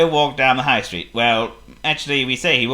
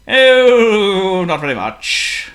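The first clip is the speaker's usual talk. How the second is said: breezy; 7 semitones higher, 7 dB louder, much slower than usual. Acted. Potto breezy.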